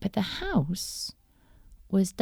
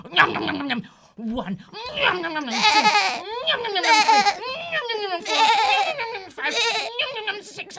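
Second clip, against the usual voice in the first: greedy gobbling; 14 semitones higher, 5 dB louder, much faster than usual. Acted. Wolf gobbling the kids.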